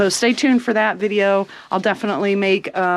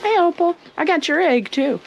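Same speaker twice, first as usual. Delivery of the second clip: pet talk; 7 semitones higher than usual. Real, not acted. Talking to a hen.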